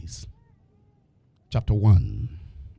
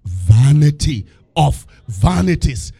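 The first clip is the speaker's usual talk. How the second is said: slow solemn reading; 3 semitones lower, 6 dB louder than usual. Shown as a demonstration. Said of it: "said the preacher".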